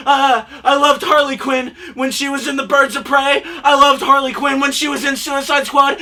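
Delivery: usual speaking voice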